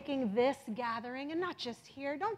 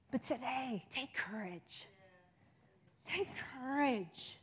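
tender encouragement; 6 dB quieter, slower than usual. Acted. Comforting the congregation.

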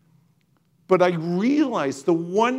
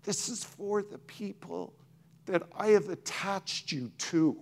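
softer echo of the quote, drawn back; hushed repeat; 8 dB quieter than usual. Retold or imitated. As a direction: let the repeat of "This is for the people" fall away to a hush. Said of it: "This is not to you personally".

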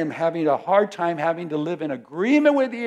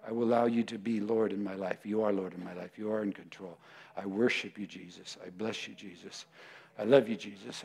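low, hushed adoration; quiet worship prayer; 8 semitones lower, 6 dB quieter than usual. Real, not acted. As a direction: lower and softer, pray to Jesus intimately.

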